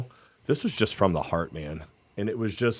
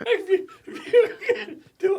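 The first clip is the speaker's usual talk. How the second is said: talking through laughter; laughing. Real, not acted. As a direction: squeeze the words out while laughing. Cracking up at the accent joke.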